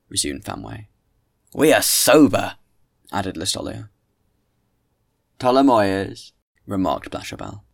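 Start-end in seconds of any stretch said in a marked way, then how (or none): 1.5-2.6 loud, emphatic objection; acted loud heckle; indignant protest. Group protests to the speaker. 5.4-6.3 loud, drawn-out call of a name; acted loud call for attention. Companion calls to the speaker.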